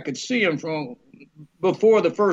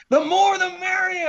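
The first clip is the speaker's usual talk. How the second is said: very high, hollered reply; high call carrying across a set; distant yell; 3 dB louder than usual. Retold or imitated. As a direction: imitate a director shouting from far off. Director yells back from afar.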